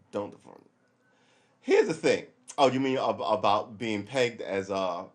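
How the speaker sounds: ordinary talk, nothing marked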